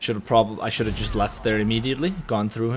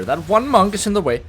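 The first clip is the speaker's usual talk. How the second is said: louder, higher frustration; exasperated; 8 semitones higher, 6 dB louder than usual. Real, not acted. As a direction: vent at the blocker. Frustrated at a blocker.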